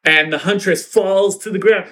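ordinary talk, nothing marked

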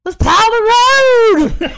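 loud, high, stretched-out shout; mock battle cry. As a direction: bellow it as a hammed-up rallying call. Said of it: "just the way that Lulu said it".

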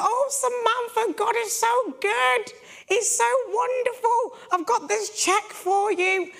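very high, slow, drawn-out mock weepy voice; imitating dramatic tearful woman. Crying pastor handing over a check, hammed-up weepy and dramatic. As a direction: ham up an overcome, crying church woman. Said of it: "She's so dramatic".

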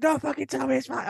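high, loud ranting at the driver; indignant high yell, half laughing; imitated screaming girlfriend. Boyfriend imitates girlfriend raging about a driver, loud and high. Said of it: "It's like".

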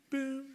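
short, high boom; staccato note. Shown as a demonstration. Showing the church a staccato note, short high boom. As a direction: imitate one clipped piano note.

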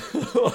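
short burst of high laughter; sudden high laugh. Real, not acted. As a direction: let a quick laugh pop out high. Laughing at own joke.

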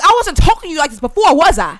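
loud, high, fast retort; heated retelling of a comeback; yelling. Retold or imitated. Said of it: "Now I'm yelling at you".

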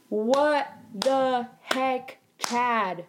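slow, drawn-out indignation; exasperated disbelief. Real, not acted. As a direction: drag out each word in disbelief. Indignant reaction to retold insult.

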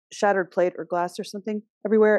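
usual speaking voice

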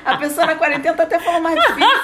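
high, loud, fast through laughter; laughing tease. Real, not acted. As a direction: laugh while teasing her. Laughing at friend's fancy words. (rindo e provocando, rápido)

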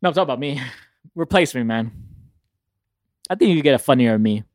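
usual speaking voice

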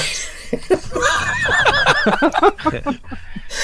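high, teary fit of laughter; crying laughter. Real, not acted. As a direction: laugh till you cry. Laughing hard at squirrel story.

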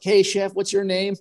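high, friendly greeting; imitating the chef. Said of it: "You were like".

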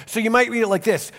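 normal talking voice

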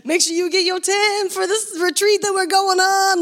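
high-pitched mock nagging voice; Courtney. Friend reminding everyone about the retreat, high mocking imitation. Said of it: "Courtney's over here being like".

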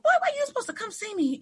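high, quick retold challenge; mock scolding. Teacher to student who stayed away, high quick scolding. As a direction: scold him fast and high.

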